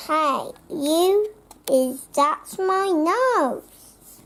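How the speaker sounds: usual speaking voice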